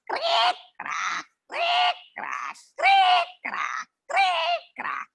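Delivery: high squeaky creak, crack; creaky rocking chair. A narrator's reading. Narrator mimics the old lady's creaking rocking chair.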